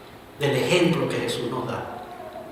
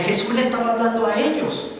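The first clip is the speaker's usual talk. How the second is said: ordinary delivery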